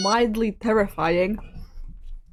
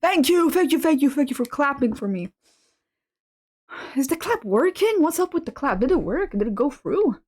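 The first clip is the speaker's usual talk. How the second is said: higher, bubbly thank-yous; excited; 6 semitones higher than usual. Real, not acted. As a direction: gush over the applause. Excited high thanks for clapping.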